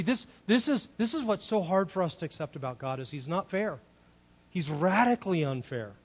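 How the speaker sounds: usual speaking voice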